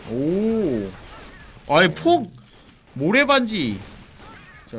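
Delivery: ordinary talk, nothing marked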